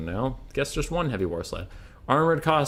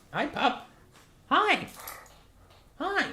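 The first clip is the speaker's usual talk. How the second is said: very high sing-song puppy voice; 4 dB quieter, slower than usual. Real, not acted. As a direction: coo at the dog in a squeaky voice. Talking to puppy.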